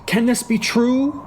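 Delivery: high, rising disbelief; crying out. Acted. Hamlet questioning the ghost's revelation, crying out. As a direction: ask it in shock. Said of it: "cried Hamlet".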